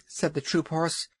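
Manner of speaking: ordinary delivery, nothing marked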